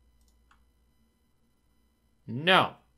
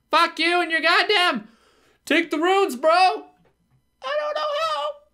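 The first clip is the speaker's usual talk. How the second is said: much higher, louder, slow stretched rant; exasperated screaming at the game; frustrated yell; 12 semitones higher, 5 dB louder, much slower than usual. Real, not acted. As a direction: yell at the useless hint-giver. Ranting at an unhelpful skull.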